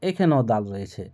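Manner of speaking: ordinary talk, nothing marked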